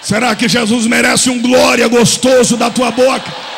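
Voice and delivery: apelo alto e lento; pregação inflamada. Acted. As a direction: arranca o glória do povo. Apelo à congregação. (pregador pedindo glória aos brados)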